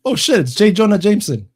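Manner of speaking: loud, high excited outburst; excited recognition. Real, not acted. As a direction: blurt it out, loud and high, on spotting someone. Recognizing an actor in the clip.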